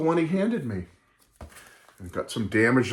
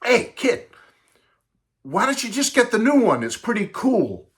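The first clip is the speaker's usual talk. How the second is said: put-on much higher, casual teen voice; laid-back teenager talking to a little boy; seventies teen; 8 semitones higher than usual. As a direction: toss it off like a cool seventies kid. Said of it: "Typical seventies kid said".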